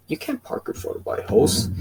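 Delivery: lightly voiced cranky neighbor; a hint of the neighbor's high put-on voice; Kevin voice. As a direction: neighbor arguing over the parking spot. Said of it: "my Kevin voice".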